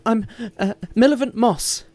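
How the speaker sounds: high, fast, stammering reply; flustered before the guards; flustered farmer woman. Acted. Flustered farmer woman answers the guards, high and fast.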